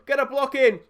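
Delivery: loud, high urgent call to block; urgent plea. Real, not acted. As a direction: shout at the defenders to block. Begging defenders to block.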